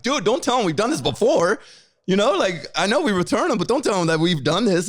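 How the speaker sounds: frantic warning not to confess; panicked plea. Retold or imitated. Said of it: "And I'm like".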